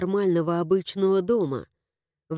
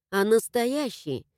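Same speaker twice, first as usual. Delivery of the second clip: ordinary delivery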